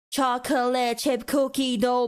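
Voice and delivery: "Chocolate chip cookie dough" slowly, syllable by syllable; laboured syllables. Shown as a demonstration. Mocking the long name.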